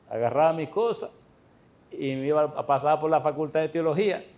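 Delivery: ordinary talk, nothing marked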